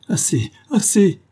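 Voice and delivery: high, loud, slow cry of terror; terrified. A narrator's reading. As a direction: beg in terror for it to stop. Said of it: "bégaya Big Johnson, vert de terreur". (cri de terreur)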